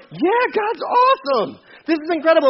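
high, stretched-out cheer; elated realization. Acted. Believer who has come to know God's will, elated. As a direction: burst out high with delight. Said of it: "You're going".

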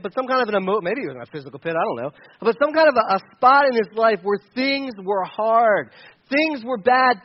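normal talking voice

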